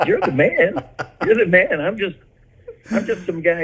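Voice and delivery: high-pitched, half-laughing humble protest; incredulous protest. Retold or imitated. Singer retelling his reply to Toby, high incredulous protest. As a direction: pitch it up, modestly disbelieving.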